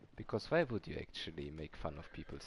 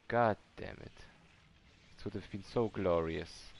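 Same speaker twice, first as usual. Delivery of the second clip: frustrated outburst; 6 dB louder than usual. Real, not acted. Failed skip, exclaims in frustration.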